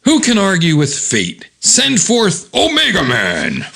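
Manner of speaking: high, theatrical announcer-like boom; comic villain's proclamation. Acted. Character throws the long scroll and summons Omega Man, grandly theatrical. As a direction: proclaim it grandly, stretching the final name. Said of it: "and he goes".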